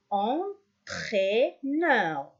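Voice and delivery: "Entraîneur" slowly as en, traî, neur; slow "Entraîneur" with gaps between syllables; split into syllables. Shown as a demonstration. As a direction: break "Entraîneur" into three for counting. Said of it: "On a trois".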